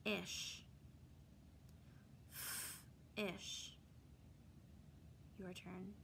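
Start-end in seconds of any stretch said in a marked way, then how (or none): 0.0-3.8 "F-ish" split into onset and rhyme; sounding out words. Shown as a demonstration. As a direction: say "F-ish" with a gap after the F.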